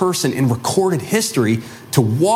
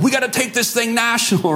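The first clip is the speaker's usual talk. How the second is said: lightly voiced fired-up follower; 8 semitones higher than usual.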